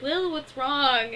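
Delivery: high, slow, sickly-sweet "Lil, what's wrong?"; sugary fake concern. Angelica sweetly tricking Lil, mock syrupy concern. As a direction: lay on false sympathy, drawn out and loud.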